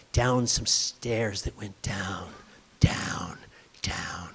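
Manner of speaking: spooky whisper, fading away. A narrator's reading. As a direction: whisper it down into the dark. Storyteller drops to whisper.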